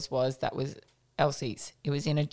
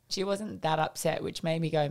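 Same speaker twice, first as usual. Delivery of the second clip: usual speaking voice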